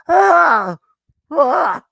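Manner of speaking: loud, high open "Ah!"; throat-clearing "Ah!". Real, not acted. Host clearing throat before the show, loud high throat-clearing cry. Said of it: "Let me clear it".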